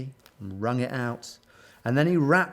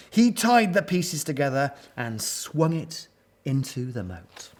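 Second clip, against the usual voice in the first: plain reading voice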